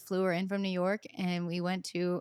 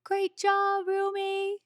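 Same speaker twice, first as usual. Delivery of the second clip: cheering dad; 12 semitones higher, much slower than usual. Retold or imitated. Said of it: "And we hear, like".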